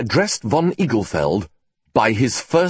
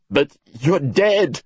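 blurted out; 6 semitones higher, slower than usual. Acted. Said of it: "he blurted out".

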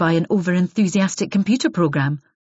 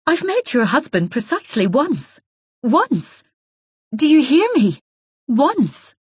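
indignant denial; 8 semitones higher than usual. Acted. Isabel denies the affair to the caller, indignant and insistent. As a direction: hammer the point home.

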